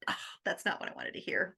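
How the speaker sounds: breathless, hushed letdown; whispered to herself after the teacher's advice; whispered dismay. Retold or imitated. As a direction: mutter it under your breath in dismay. Her dismayed reply, quoted.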